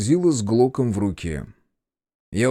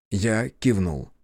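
ordinary delivery, nothing marked

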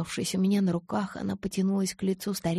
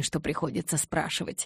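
ordinary delivery

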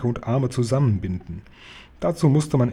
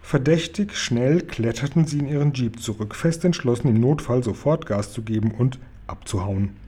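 ordinary delivery, nothing marked

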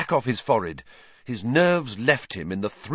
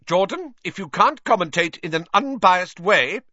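high scolding rebuke; a hint of a stern teacher; 9 semitones higher, 3 dB louder than usual. A narrator's reading. The professor scolds Jordan, lightly voiced stern rebuke. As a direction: reprimand the commentator. McGonagall reprimanding the commentator.

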